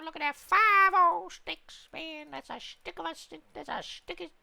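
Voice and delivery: very high, squeaky little creature voice; squeaky cartoon voice. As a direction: squeak it out like a tiny cartoon critter. Squeaky cartoon voice while crafting.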